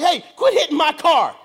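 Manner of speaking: high, loud indignant holler; retold yell. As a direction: call out the window at attackers. Yelling out the car window.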